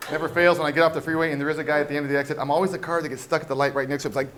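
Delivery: normal talking voice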